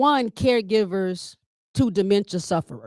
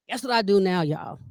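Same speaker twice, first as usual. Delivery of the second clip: ordinary talk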